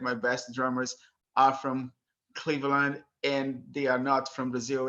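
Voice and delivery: ordinary talk, nothing marked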